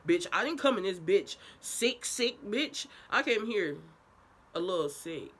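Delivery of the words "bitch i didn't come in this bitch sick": ordinary talk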